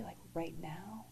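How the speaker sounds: hushed, stunned question; whispered disbelief. Retold or imitated. Told to go right away, she whispers back to the doctor. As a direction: breathe out the question in shock.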